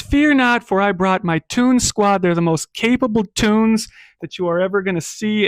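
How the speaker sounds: high, loud proclamation; grand governor. Acted. Governor reassuring the crowd about his squad, grandly loud. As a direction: boom it out like a showman governor.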